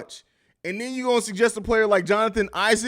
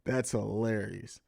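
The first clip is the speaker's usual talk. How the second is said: ordinary delivery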